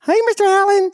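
very high squeaky mock greeting; high-pitched impression of a visitor; mimicked greeting. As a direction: imitate a visitor calling hello in falsetto. Imitating a visitor's cheery call.

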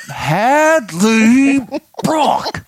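loud, high, drawn-out name; stern full-name reprimand. Retold or imitated. Dad calling out his daughter, stern disbelief. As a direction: imitate an exasperated parent, each name stretched.

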